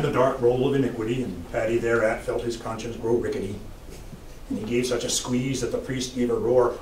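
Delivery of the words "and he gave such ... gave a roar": ordinary delivery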